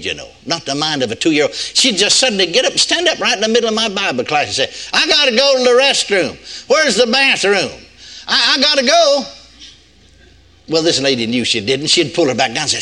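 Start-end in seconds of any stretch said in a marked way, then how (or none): 4.9-9.3 high, urgent mock child voice; little kid imitation. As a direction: whine it like an impatient small child, imitating. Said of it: "acting like a little bitty kid".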